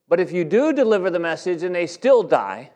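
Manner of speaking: loud, high, driving sermon voice; full-force preaching. Acted. Pastor preaching Ezekiel's warning to the congregation, at full force.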